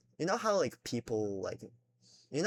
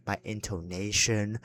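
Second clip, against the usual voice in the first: ordinary delivery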